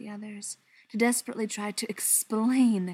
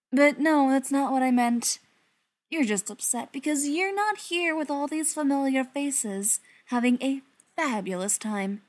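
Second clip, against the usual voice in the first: higher, louder gloating villain; taunting villain; 5 semitones higher, 4 dB louder than usual. Acted. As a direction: gloat over his rival.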